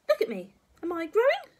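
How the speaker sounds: high, loud granny demand; challenging grandma. Acted. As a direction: challenge the boy imperiously.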